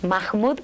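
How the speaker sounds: Mahmoud with a raspy Spanish J for the H. Shown as a demonstration. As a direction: sound the H in Mahmoud as a J. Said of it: "la H se pronuncia como J".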